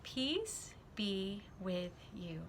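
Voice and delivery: Jesus calms his scared friends with a hushed blessing; gentle blessing. Acted. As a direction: breathe peace over frightened friends. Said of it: "he said three, four words".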